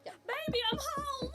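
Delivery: high sing-song call to her husband; lilting baby i'm home; mother calling out. Acted. GM voices mother greeting husband.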